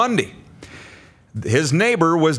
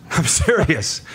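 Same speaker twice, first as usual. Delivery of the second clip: ordinary talk, nothing marked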